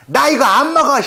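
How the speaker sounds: high, loud mock yell; shouting. Refusing the cat's dead mouse, mock yell. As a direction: yell at the cat in playful disgust. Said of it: "제가 소리를 지르죠".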